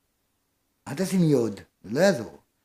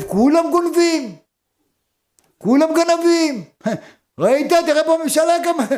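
mocking thief voice; 11 semitones higher, 5 dB louder than usual. Thief excusing himself, mocked.

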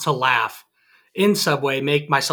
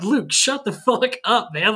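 higher, drawn-out mock exasperation; exasperated; 6 semitones higher than usual. To a friend ranting on the phone after being fat shamed, retold exasperation. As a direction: retell cutting off a ranting friend.

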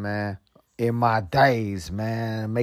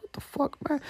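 mock disbelief; 14 semitones higher, 12 dB quieter than usual. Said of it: "I'm like".

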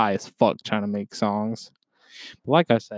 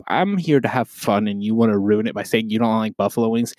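normal talking voice

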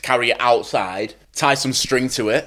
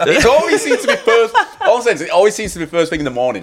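laughing; 9 semitones higher, 4 dB louder than usual. Real, not acted. Hinting at awkward morning encounters.

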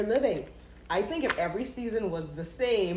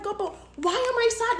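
pleading; 12 semitones higher than usual. Retold or imitated. Retold prayer while crying.

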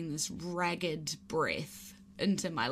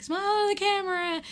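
higher, cheery instructor call; 12 semitones higher, faster than usual. Retold or imitated. Instructor calling to the jumper to pose, higher cheery voice. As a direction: do the instructor's bright command. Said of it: "he's like".

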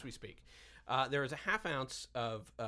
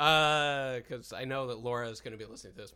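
normal talking voice

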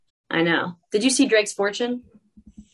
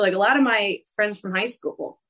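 usual speaking voice

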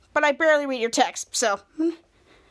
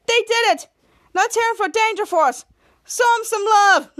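loud, high hype-man call; excited announcer; 6 semitones higher, 4 dB louder than usual. Acted. Club host hails Danger Force, excited announcing. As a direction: whip the crowd into cheering.

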